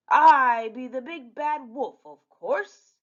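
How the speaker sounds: loud, slow, swaggering villain voice; pirate villain. Acted. Captain Hook posing as the wolf, boastful. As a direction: announce yourself like a hammy pirate.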